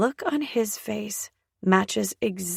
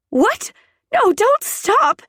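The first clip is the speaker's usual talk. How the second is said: high, loud desperate begging; desperate cry; 14 semitones higher, 7 dB louder than usual. A narrator's reading. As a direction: cry out, frantic for more. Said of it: "I practically beg him".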